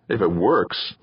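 ordinary delivery, nothing marked

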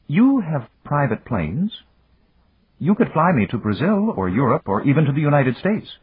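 lightly voiced young lady, pitched high; a hint of an eager heroine; eager young woman. A narrator's reading. Narrator voices Lucita's request.

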